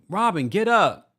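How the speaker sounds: high, loud mock order; imitated rousing call. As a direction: call out like rousing someone off the couch. Mimics Juan rousing Robin.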